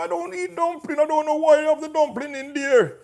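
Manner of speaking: high whiny mock complaint; high nasal-free mocking whine of a picky guest; mocking picky eater. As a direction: mimic the fussy eater who hates dumplings. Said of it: "these people miserable".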